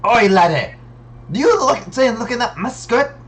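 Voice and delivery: loud, high mock Scottish challenge; Scottish accent. Player quoting a cartoon Scot, in a Scottish accent. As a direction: pick a fight in a put-on Scottish accent. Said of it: "that Scottish accent".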